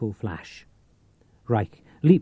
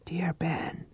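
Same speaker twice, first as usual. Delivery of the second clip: soundless, tender whisper; just shape the words with your lips; mouthed whisper; 8 semitones higher, 8 dB quieter than usual. Acted. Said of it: "mouth formed the words".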